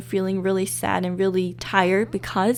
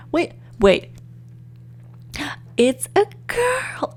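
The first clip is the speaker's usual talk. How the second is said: wait, then a high, slow delighted squeal; excited reveal; 9 semitones higher, slower than usual. Real, not acted. Vlogger learning the baby is a girl, squealing. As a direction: build to a thrilled announcement.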